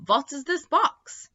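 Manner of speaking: high, slow lightly voiced vampire; ratty vampire voice. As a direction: let the vampire drawl it up high. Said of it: "vampires said".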